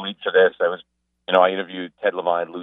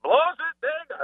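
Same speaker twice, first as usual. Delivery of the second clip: much higher, louder, exasperated Australian-free yelp of a fan; mock fan meltdown; 5 dB louder than usual. Imitating a colleague unhinged at a Sox game, high and shrill. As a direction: squeal like a fan losing his mind over the bullpen. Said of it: "He's just".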